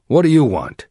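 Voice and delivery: rough bark. A narrator's reading. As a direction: bark it roughly at the child, as a narrator would. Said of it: "barked at her roughly".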